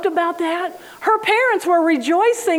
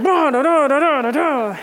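mock droning list. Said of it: "And blah, I mean".

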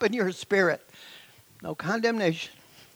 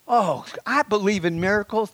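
usual speaking voice

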